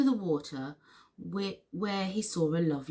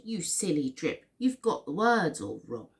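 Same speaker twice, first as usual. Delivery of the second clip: ordinary delivery, nothing marked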